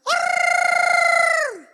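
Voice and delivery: very high, loud, drawn-out yell; deacon's shout. Retold or imitated. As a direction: imitate an excited deacon screaming out. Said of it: "he starts to shout".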